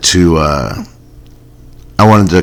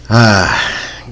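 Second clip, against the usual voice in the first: ordinary talk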